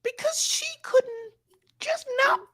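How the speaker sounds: very high, squeaky put-on voice, slow; mock squeaky voice. Mocking the prison line to cohost, squeaky high voice. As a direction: pipe it up like a cartoon.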